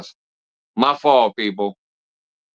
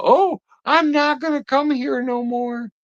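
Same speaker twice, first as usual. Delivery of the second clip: mocking crybaby; slower than usual. Mocks viewer's threat to leave.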